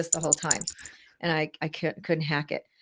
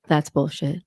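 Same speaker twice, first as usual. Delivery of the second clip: yoga teacher voice; 3 semitones lower than usual. Pretend. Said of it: "I'll use my yoga teacher voice to say".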